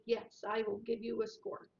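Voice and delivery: usual speaking voice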